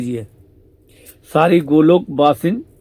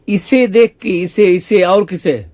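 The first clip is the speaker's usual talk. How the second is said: much higher, louder, slow exasperation; exasperated retort; 5 semitones higher, 7 dB louder, slower than usual. Acted. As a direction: spell it out, fed up. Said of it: "बोले". (बहुत ऊँची, तेज़, धीमी झुँझलाहट)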